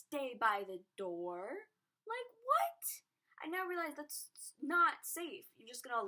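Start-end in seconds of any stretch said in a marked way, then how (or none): 2.1-2.8 much higher, louder incredulous what; outraged disbelief at the teachers. Real, not acted. Outraged at teachers not helping.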